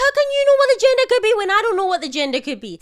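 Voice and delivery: high, loud, fast outburst; wife firing back at husband, loud and high; mock exasperated. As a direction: fire it back in disbelief. Retold argument, own side.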